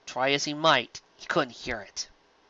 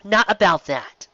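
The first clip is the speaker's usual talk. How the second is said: annoyed; 7 semitones higher, 4 dB louder, slower than usual. Acted. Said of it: "annoyed glare".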